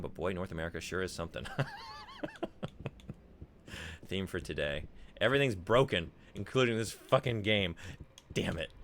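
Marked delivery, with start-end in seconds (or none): 1.5-3.1 high laugh. Real, not acted. Laughing after reading chat. 5.2-8.7 frustrated outburst. Real, not acted. Frustrated gamer venting at the game.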